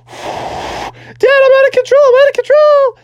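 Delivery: put-on very high, escalating kid scream; hammed-up panic. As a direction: shriek to Dad as you tumble. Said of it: "he was like".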